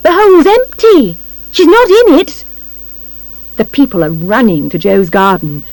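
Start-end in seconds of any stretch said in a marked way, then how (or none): 0.0-2.4 high, loud shout to a crowd; loud announcement, pitched right up. A narrator's reading. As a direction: shout the news out. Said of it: "he shouts".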